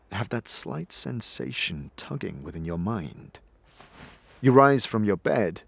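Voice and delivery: ordinary talk, nothing marked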